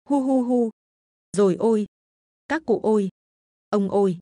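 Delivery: wailing. Acted. Old mother wailing at her son smashing the altar, sobbing. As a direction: cry out in panic for her son. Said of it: "hốt hoảng mà gào thét".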